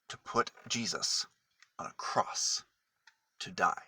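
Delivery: soft, low sombre telling; hushed. A narrator's reading. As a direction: drop to a hush for the sad part. Telling of the crucifixion.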